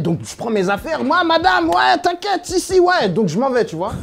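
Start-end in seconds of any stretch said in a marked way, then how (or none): 1.0-3.1 calling out. Retold or imitated. Calling out to the teacher.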